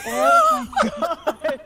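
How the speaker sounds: high, slow laughing "oh my God"; laughing disbelief. Real, not acted. Friend laughing about a disturbing movie, high and slow. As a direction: stretch it out through a laugh.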